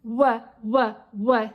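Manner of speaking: three short "wuh" sounds for "W"; isolated W sound. Shown as a demonstration. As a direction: punch out each "W" as wuh, separately. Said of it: "W makes the".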